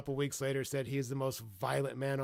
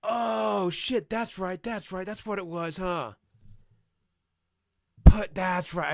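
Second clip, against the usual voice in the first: excited reaction; 6 semitones higher, 11 dB louder, slower than usual. Real, not acted. Reacting to fight scene.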